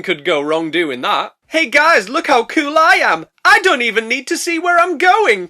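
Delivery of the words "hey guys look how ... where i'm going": loud, high cocky brag; show-off boast. Acted. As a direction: show off to your mates while speeding.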